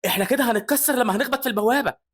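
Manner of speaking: high-pitched alarmed cry; panicked. Acted. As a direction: blurt it in panic, voice shooting up. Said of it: "بيقول كريستوفر بفزع".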